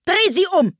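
high, irritated command; cross queen giving orders; annoyed. A narrator's reading. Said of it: "wandte sich ärgerlich von ihm ab".

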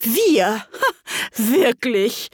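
high, scornful exclamations; indignant. A narrator's reading. Trembling mouse answers Alice's apology, sharply indignant. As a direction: spit it back, affronted. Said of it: "die bis zur Schwanzspitze zitterte".

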